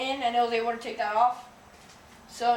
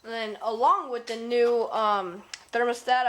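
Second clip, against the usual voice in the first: normal talking voice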